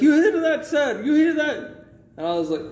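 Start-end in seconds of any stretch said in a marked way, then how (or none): 0.0-1.6 put-on higher, louder gloating Indian accent; gloating scammer. Scammer bragging to his caller, excited Indian accent. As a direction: show off the cheering, mock Indian accent. Scammer boasting during call.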